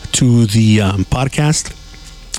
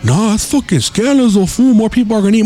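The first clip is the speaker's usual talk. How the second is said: high, whiny coworker complaint; mock coworker scolding; 9 semitones higher than usual. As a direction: imitate the coworker's griping, voice raised in pitch. Coworker's reply in retold quarrel.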